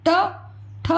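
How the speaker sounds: normal talking voice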